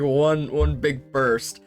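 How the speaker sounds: ordinary delivery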